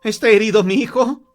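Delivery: high, loud, panicked question; frantic mother. Acted. Mother asks the visitor about her son, frantic. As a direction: blurt it out, beside yourself with dread. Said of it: "preguntó enloquecida la madre".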